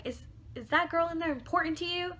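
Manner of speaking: high-pitched mock demand, not fully yelled; retold yelling. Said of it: "so she's yelling at him".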